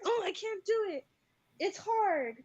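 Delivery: high, drawn-out mock whine; whining child imitation. Pupil protests hard work to teacher, whining loudly. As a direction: wail it like a kid giving up on schoolwork.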